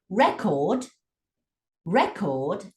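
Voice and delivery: RE-cord, the noun, strong first beat; slow, separated RECord; stress on first syllable. Shown as a demonstration. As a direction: punch RE in record. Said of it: "if you get the stress wrong".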